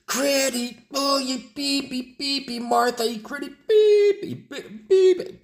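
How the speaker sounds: exploding chef. Acted. Gordon exploding at Crit, high furious bleeped rant. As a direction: scream at the critic. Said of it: "Y Gordon explota".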